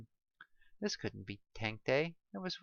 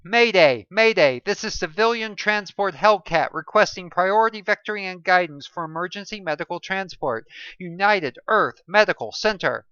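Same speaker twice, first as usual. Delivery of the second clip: loud, raised radio distress call; urgent mayday call; 6 semitones higher, 12 dB louder than usual. Acted. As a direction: call it into the mic like lives depend on it. Pilot radios an emergency call.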